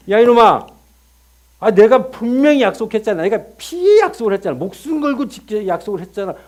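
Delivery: loud, high scolding shout; angry God. Acted. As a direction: thunder at a disobedient servant. (화난 하나님의 호통)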